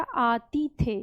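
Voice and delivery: आतिथेय drawn out slowly, long आ; teacher unveiling the derived word slowly; slow clear word. Shown as a demonstration. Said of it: "ये शब्द क्या बना है".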